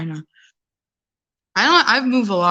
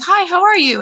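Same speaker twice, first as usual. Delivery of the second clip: high, loud bright greeting; cheery hello; 12 semitones higher, 6 dB louder than usual. Retold or imitated. Greeting unfriendly New Yorkers, high loud cheerful hello. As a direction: greet strangers with big enthusiasm. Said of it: "I'm like".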